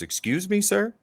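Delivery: high, surprised guard asking back; a guard caught off guard, pitch jumps up; startled guard. Acted. GM voicing a guard interrupted mid-conversation, high and surprised.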